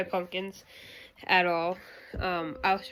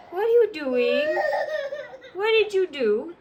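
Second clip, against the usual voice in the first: high, louder playful questions with a laugh in them; 13 semitones higher, 5 dB louder than usual. Real, not acted. Mom talking to baby.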